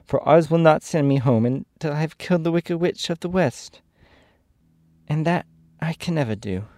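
put-on little girl's high-pitched lament; sad. A narrator's reading. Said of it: "she said sadly".

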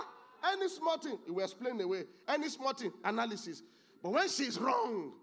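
high, loud, punchy preaching; impassioned preaching. Real, not acted. Preacher describing husbands who never admit wrong, impassioned. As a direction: raise the voice and hammer each phrase.

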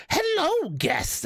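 high sing-song hotel bellboy welcome; creepy bellboy greeting. Retold or imitated. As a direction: greet them like a spooky motel bellboy, a touch hammed-up.

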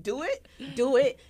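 loud, high taunting dare. Retold or imitated. Daring someone to call her bluff, loud taunt. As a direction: egg them on, loud and high.

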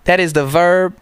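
thick mock Chinese accent, pitched high. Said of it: "Chinese accent".

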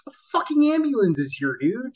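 high-pitched, urgent objection; acted-out exasperation; exasperated retold protest. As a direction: argue it high, like he's missing the obvious. Retold argument with boss.

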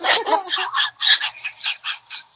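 kitten voice. Pretend. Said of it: "I make a mad imitation of a cat".

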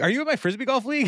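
high and fast eager question; eager goofy question. Retold or imitated. Andy eagerly quizzing Dunn, high and fast. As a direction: rattle it off like an excited kid.

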